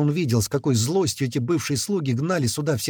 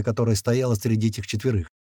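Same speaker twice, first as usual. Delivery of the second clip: plain reading voice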